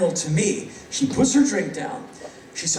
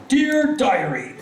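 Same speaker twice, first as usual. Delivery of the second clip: gushing diarist; 6 semitones higher than usual. Acted. Comic writing in his journal, mock breathless excitement. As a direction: gush it theatrically into a diary.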